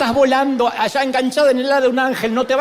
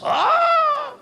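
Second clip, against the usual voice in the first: un ah agudo y extasiado de adorador. Acted. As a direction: suelta un ah largo, muy agudo. Suspiro de adoración. (ah extasiado, muy agudo)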